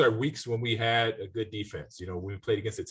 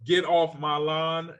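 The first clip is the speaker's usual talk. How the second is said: drawn-out, higher and louder, like a show host introducing a recurring bit; slow it down and sell the segment name; segment title announcement; 7 semitones higher, 4 dB louder, much slower than usual. Acted. Host introducing the next segment to his co-host, in a drawn-out announcer voice.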